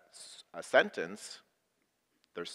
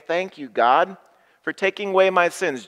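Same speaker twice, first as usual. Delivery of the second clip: a higher, faster "Thank you, God" to show the words; cheerful leading line; 6 semitones higher, 9 dB louder than usual. Shown as a demonstration. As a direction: lead the thanks briskly and brightly. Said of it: "Join me here and at home".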